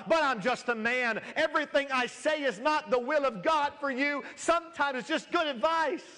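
high, loud impassioned pleading; fervent preaching. Acted. Pastor insisting to his congregation he is just a man, loud and high. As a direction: plead it out at full force from the pulpit.

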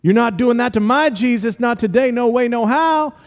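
put-on high-pitched, defiant good-old-boy protest. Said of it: "might very well be a good old boy".

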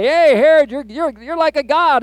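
high, loud flattering voices; fawning crowd. Retold or imitated. Crowd flattering Herod after his speech, high and gushing. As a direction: gush up at the king like a fawning crowd.

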